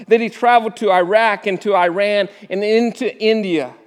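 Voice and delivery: ordinary delivery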